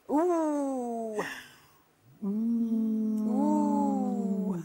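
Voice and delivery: lips pushed out into a long "Ooh."; puckered ooh. Shown as a demonstration. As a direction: round and stretch the "Ooh." sound. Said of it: "Pucker up your lips and say".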